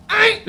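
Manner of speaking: high yelping aïe; mock scream. Man with his leg in the fire, mock yelp. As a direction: yelp it like a burned foot.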